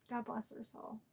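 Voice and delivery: very soft, sighing blessing; real quiet grief; emotional. Real, not acted. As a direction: breathe it out gently, moved by grief. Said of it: "sorry, I'm getting emotional".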